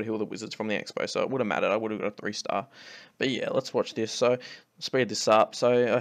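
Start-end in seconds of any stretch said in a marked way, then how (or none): none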